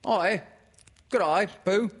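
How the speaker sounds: very high, loud character voice; chirpy high greeting; Kanga. Acted. Mother greeting Pooh, in a high loud character voice.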